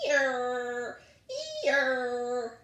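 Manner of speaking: croaking, honking donkey bray; loud honking bray. Acted. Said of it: "loud, croaking, honking".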